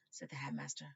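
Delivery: ordinary delivery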